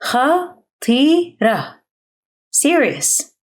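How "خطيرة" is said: خطيرة slow and higher, three separate syllables. Shown as a demonstration. Teacher breaking up a word for learners, syllable by syllable. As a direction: draw out خطيرة with gaps between syllables.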